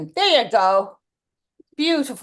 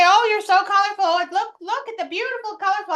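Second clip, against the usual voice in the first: gushing admirers; 8 semitones higher, faster than usual. Retold or imitated. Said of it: "People always say".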